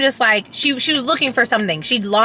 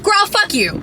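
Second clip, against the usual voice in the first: high, sassy mock snap; 11 semitones higher than usual. Real, not acted. Mom venting at her three-year-old, high sassy mock snap. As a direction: fire it back at her, half joking. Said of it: "So on my emotional side".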